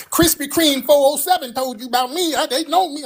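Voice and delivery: high whining insistence; put-on squeaky, aggrieved voice; mock sassy whiner. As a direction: imitate a sassy man indignantly arguing. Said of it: "What the hell you so sassy".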